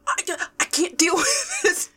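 high, slow, breaking into laughter; imitated laughing disbelief. Second mom laughs at daughter in the cinema, imitated laughing disbelief. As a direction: mimic someone too tickled to get it out. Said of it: "She starts laughing at me".

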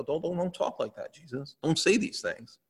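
quiet urgent pleading, almost under the breath; hushed rebuke. Retold or imitated. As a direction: pull him aside and hush the warning. Peter rebukes Jesus aside.

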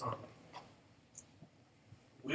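breathy, drawn-out whisper; whispered aside. Real, not acted. Preacher confides to congregation, whispering. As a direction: drop to a slow whispered aside.

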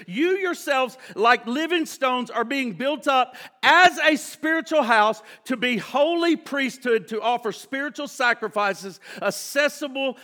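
high, emphatic sermon voice; full-force preaching. Real, not acted. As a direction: drive the scripture home, stretching key words.

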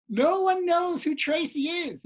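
loud, high, drawn-out complaint; exasperated. Retold or imitated. Performer tells heckler nobody knows Tracy, exasperated. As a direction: speak for the whole baffled room.

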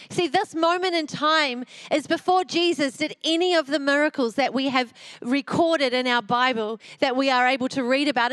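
ordinary talk, nothing marked

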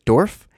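put-on a little kid trying out "Dwarf?"; child's puzzled dwarf. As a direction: mimic a five-year-old asking "Dwarf?". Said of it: "he said".

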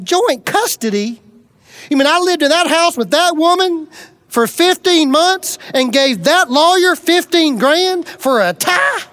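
rising, incredulous outrage at the ruling; retold disbelief. Shocked reaction to ruling.

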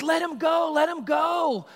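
high loud chant of let him go; crowd shouting. Retold or imitated. Passengers screaming at police, loud shout. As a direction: yell it, banging on the glass.

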